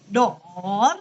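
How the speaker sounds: "dor" dipping low, then rising; third tone. Shown as a demonstration. Teacher showing the class tone three, "dor" in third tone. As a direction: drop "dor" low and swing it back up. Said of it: "Nada tiga".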